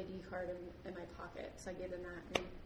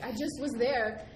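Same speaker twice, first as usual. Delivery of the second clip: slow, louder complaint; exasperated protest; 3 semitones higher, 7 dB louder, slower than usual. Retold or imitated. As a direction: drag it out, fed up. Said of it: "I was like".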